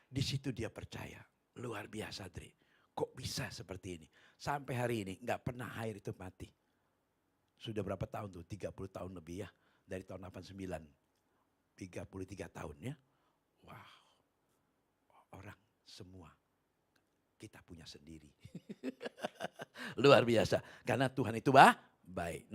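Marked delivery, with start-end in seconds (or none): 1.6-4.1 whispered wonder; awed whisper. Retold or imitated. The friend marvels at the clear water in a whisper. 13.7-18.3 whispered aside. Real, not acted. Hushed aside. 18.4-20.0 high airy laugh; breathy laugh. Real, not acted. Preacher laughs softly at the miracle story.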